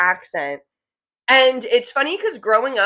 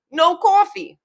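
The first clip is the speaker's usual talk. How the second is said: high, emphatic New York accent; 8 semitones higher than usual. Shown as a demonstration. Capping the saying, emphatic New York accent. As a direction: cap it off in broad New Yorkese. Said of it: "Now with the accent".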